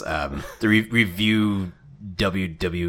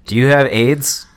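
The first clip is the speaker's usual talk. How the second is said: put-on a little louder kid voice, asking innocently; a hint of a little boy; 4 semitones higher, 7 dB louder than usual. Acting the Skittles ad, the boy asks the girl loudly. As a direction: play the boy from the ad. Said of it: "you be the little boy".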